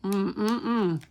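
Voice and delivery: talking while chewing; words through a crunchy bite; mouth full. Real, not acted. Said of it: "Sorry for the crunching".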